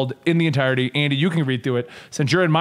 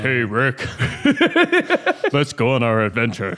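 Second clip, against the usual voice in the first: silly put-on voice; 5 semitones lower than usual. Mocking Morty with random accent.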